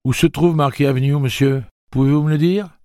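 high, loud eager question; eager boy's voice. Acted. Jake eagerly asking the old man the way, high boyish. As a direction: ask like an excited boy.

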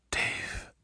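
soft, half-voiced murmur; hushed and low in volume. A narrator's reading. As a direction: murmur the name under your breath. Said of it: "murmura-t-elle".

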